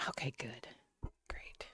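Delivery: breathy whispered aside. Real, not acted. Streamer hushing an aside to chat, whispered. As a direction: whisper it under your breath. Hushed aside during loud sirens.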